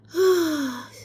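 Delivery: loud weary sigh; heavy sigh. Real, not acted. Weary sigh before eating.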